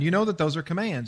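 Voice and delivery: usual speaking voice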